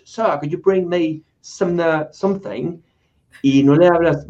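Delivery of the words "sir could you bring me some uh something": slow, stiff British English; light mock British accent. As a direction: ask in a prim British manner. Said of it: "el acento british".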